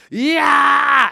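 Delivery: loud, high bitten man's scream; imitated scream. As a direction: yell as if suddenly bitten. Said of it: "and he goes like".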